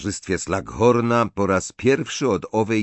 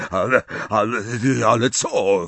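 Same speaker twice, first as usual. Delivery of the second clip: halting, stunned stammer. A narrator's reading. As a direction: stammer it out in shock. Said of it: "wyjąkał Slughorn". (jąkając się, w szoku)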